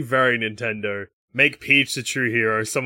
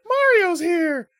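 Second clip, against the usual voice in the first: much higher, drawn-out princess call; very high, slow Peach voice; much slower than usual. Retold or imitated. Peach announcing Mario.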